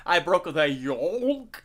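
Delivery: "yolk" said slowly with the L clearly sounded; emphasized L. Retold or imitated. Friend mocks the streamer's slow "yolk", L fully sounded. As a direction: lean on the L in "yolk" while mocking him. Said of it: "he'd really emphasize the L on it".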